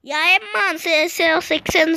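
ordinary talk, nothing marked

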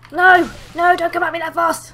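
loud protest at an attacker; alarmed shout. Real, not acted. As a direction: shout it out as the enemy rushes in. Calls out as enemy rushes.

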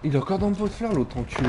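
high outraged callout; indignant callout. Real, not acted. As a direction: sound fed up and disbelieving. Camper still in flowerpot.

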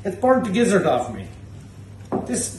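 normal talking voice

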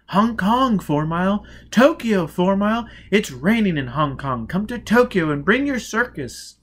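high, loud, gushing revelers; excited party crowd calling. Acted. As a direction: sing out the invitations across the room.

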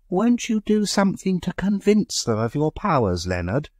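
high-pitched pleading aunt. A narrator's reading. Aunt begging her nephew to show his powers, pleading. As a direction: coax her nephew to perform. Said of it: "she pleaded".

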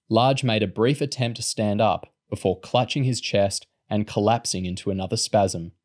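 ordinary delivery, nothing marked

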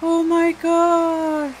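high, slow, loud Oh my God; drawn-out frightened cry. Real, not acted. Player terrified by a monster, slow frightened cry. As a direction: stretch it out in real fright.

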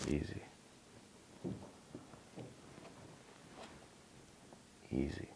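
low, soft, settling voice; calm the dog down gently; calm easy. Real, not acted. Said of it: "when my energy is kinda settled down".

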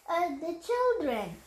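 usual speaking voice

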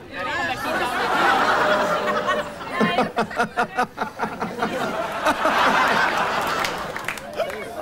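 wheezing high giggle that goes on and on; long helpless laugh. Real, not acted. Fatty cracking up after the pest walks off, high wheezing laugh.

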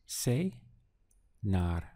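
Cenar said slowly, split into two clear syllables, ce-nar; slow syllable demo. Shown as a demonstration. Teacher models the new word Cenar for learners, slow and syllable by syllable. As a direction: stretch out Cenar, each syllable distinct. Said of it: "Just two syllables".

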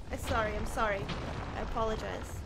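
normal talking voice

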